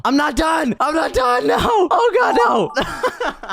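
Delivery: high, frantic yell; shrieked, desperate protest; panicked shouting. Real, not acted. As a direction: shout it in rising panic as time runs out. Time runs out on drawing.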